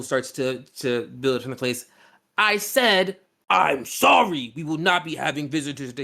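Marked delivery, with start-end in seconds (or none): 2.4-6.0 commanding repeat. Acted. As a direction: say it again and mean it. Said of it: "he says it again in a tone that means it".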